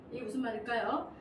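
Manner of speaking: ordinary talk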